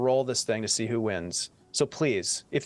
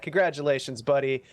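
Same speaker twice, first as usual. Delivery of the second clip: ordinary talk, nothing marked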